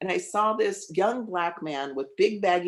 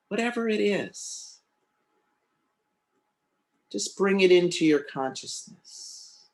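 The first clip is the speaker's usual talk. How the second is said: guided meditation; 3 semitones lower than usual. Acted.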